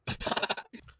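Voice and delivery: short, voiceless chuckle; amused laugh. Real, not acted. Streamer reacting to an awkward interview, amused chuckle. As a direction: chuckle under the breath at what was heard.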